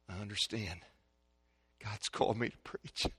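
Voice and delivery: hushed, low, sincere confession; quiet acceptance. Retold or imitated. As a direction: say it softly as a humble realisation. Son quietly accepts the call.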